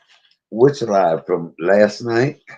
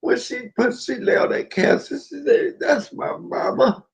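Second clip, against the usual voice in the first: high-pitched, tearful and breaking up; really crying as you tell it; choked up with high sobbing words; 8 semitones higher than usual. Real, not acted. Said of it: "I cry every time".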